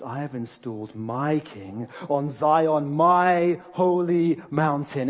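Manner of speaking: slow, weighty divine proclamation; drawn-out, emphatic decree swelling in force; thundering God. Acted. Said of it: "when God thunders and says".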